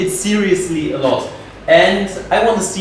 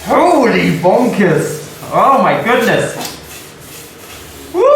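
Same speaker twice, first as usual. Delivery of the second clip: higher, louder, drawn-out amazed cry; excited exclamation; 4 semitones higher, 3 dB louder, slower than usual. Real, not acted. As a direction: blown away, shout it out. Wood reveals stunning curl.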